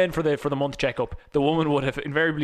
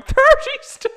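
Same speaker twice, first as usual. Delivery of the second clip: high, loud incredulous squeak; shrieking thirty stone in disbelief; shocked disbelief; 11 dB louder, slower than usual. Retold or imitated. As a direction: gasp it out in disbelief. Shocked reaction to the goal.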